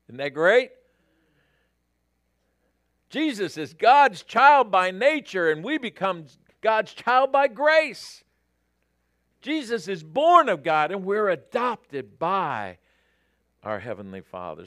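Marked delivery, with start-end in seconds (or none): 3.1-14.7 loud, high, excited preaching; fired-up pulpit delivery, ringing out; exultant proclamation. Real, not acted. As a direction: proclaim it with rising joy to the room. Excited repeat of quote after "great.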